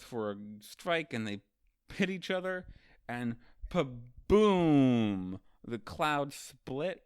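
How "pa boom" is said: loud, long-drawn "boom"; booming impact noise. Retold or imitated. Kaido and Luffy hit each other, booming impact. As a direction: imitate a huge explosion, stretching it out. Imitates the clash of the strike.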